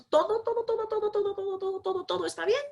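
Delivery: high, airy todo todo chant; singsong all is fine. Pretend. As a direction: float it like a blissed-out monk. Mocking the carefree monk patient.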